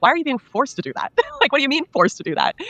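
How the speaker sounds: high-pitched, baffled questioning; incredulous. Retold or imitated. Questioning a colleague who claims she was forced, incredulous. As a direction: demand it in disbelief. Her own incredulous retort retold.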